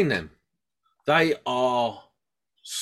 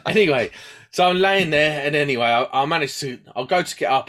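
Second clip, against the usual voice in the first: ordinary talk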